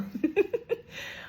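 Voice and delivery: a high, soft little giggle. Real, not acted. Laughing softly at herself while telling of crying. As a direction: let out a high, hushed laugh. Laughing at her own tears.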